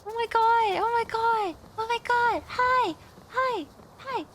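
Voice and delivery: high, loud excited squealing. Real, not acted. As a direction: squeal at a cute kitten. Spotting a cute cat creature.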